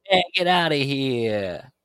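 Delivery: mock Chicago accent, high and drawn out; slow, exaggerated Chicago drawl. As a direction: do a hammed-up Chicago wise guy.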